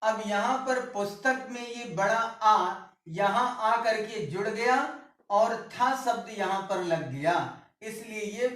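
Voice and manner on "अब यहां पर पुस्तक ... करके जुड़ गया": ordinary talk, nothing marked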